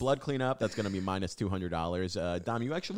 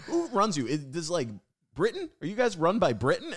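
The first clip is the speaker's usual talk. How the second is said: clueless comic; 10 semitones higher, 4 dB louder than usual. Retold or imitated. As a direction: play him baffled and dopey. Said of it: "He goes".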